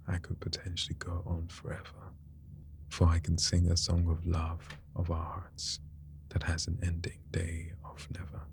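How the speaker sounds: whispered poem. Acted. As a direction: recite it softly like a wedding poem.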